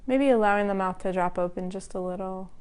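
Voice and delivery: calm, even voice with long pauses between phrases; guided meditation. Acted. Teacher guiding the class to relax part by part, calm meditation voice. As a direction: lead a body scan, unhurried and level. Leads a body-scan meditation.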